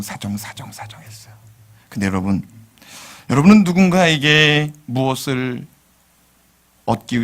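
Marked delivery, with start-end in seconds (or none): 0.0-1.3 softer and softer into a whisper; fading whisper. Real, not acted. Pastor fading to a whisper about pleading. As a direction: let it trail off whispered. Repeated word fades to whisper.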